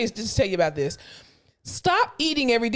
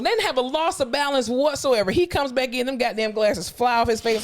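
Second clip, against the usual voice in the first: ordinary talk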